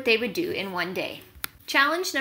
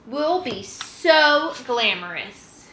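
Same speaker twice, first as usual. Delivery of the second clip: slow, drawn-out dreamy hens; swooning hens; much slower than usual. Acted. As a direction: stretch every word, swooning. Hens swooning over glamour.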